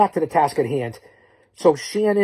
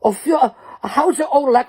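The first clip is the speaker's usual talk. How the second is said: mock Aussie accent; 8 semitones higher, 5 dB louder than usual. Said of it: "impersonations, I can do it all".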